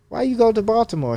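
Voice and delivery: a hint of the puzzled doctor's voice.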